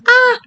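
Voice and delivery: sudden high yelp of surprise; startled yelp. Real, not acted. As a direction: jump and yelp. Startled by game event.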